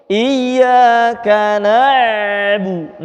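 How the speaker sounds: na'bu with the ain held unbroken, slow; slow recitation with an unbroken ain; flowing ain. Shown as a demonstration. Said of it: "huruf ain".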